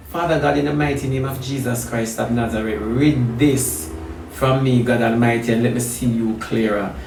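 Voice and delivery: low, soft prayer; earnest prayer. Real, not acted. Preacher asks God to rid this from me, soft prayer. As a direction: bring it down into quiet pleading.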